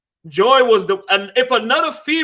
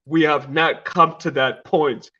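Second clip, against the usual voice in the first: Coming to America royal; 6 semitones lower than usual. Acted. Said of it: "You remember, you remember Coming to America?".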